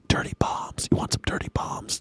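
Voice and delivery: hushed, breathy mock warning; mock spooky whisper. Streamer whispering to chat about dirty bombs, mock ominous whisper. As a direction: whisper it like a dark secret.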